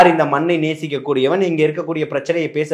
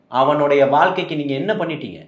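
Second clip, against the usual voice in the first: usual speaking voice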